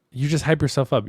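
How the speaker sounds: ordinary talk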